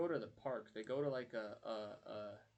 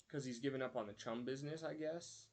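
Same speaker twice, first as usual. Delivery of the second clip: ordinary talk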